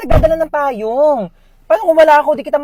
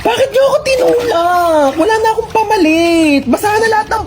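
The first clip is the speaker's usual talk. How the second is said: shocked protest; 9 semitones higher than usual. Acted. Pushed into the pool, protesting.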